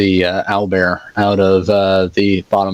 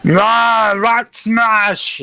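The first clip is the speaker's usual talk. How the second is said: high, drawn-out smashing yell; Hulk-style battle cry; much slower than usual. Acted. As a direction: roar it out like a Hulk on the attack. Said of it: "going to go all Hulk smashy".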